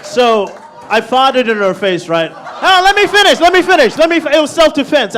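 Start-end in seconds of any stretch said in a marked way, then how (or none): 2.3-5.1 high, loud pleading over the crowd; indignant, insistent self-defence; loud protest. Acted. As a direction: shout them down to defend yourself. Protests over audience reaction to confession.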